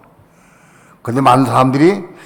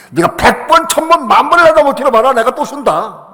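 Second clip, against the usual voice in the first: loud, much higher defiant shout; strong shout; 9 semitones higher, 4 dB louder than usual. Retold or imitated. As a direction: cry out against the devil. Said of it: "강하게 외쳐서".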